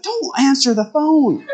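high, put-on principal voice scolding; mock principal. Principal scolding the boy for answering, high mock voice. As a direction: squeak it out like a fussy principal. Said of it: "he's like".

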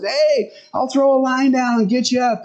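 lightly calling down to the swimmer; hammed-up shout from above; mild mock yell. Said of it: "he yells down and says".

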